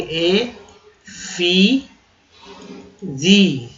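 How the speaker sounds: ए, फी, जी with clear pauses between; each syllable of "ए फी जी" standing alone. Shown as a demonstration. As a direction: spell out "ए फी जी" slowly, one syllable at a time. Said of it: "एफिजी को ऐसे लिखेंगे".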